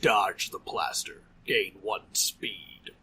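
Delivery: low, very slow horror narrator; deep, lingering Lovecraftian storyteller. Acted. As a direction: draw out each word like an ominous pronouncement. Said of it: "Should I do my, uh, Lovecraft voice".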